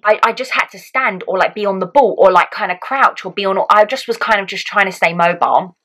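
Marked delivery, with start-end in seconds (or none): none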